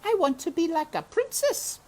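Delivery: put-on high little girl's voice; high wishful girl's voice; Meg wishing. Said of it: "said Mink".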